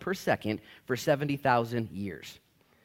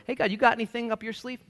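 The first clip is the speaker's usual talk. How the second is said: higher-pitched, half-hearted request; sheepish, raised-pitch plea; tentative mock prayer; 11 semitones higher, 3 dB louder than usual. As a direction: ask God hopefully, as a long shot. Acts out his weak prayer.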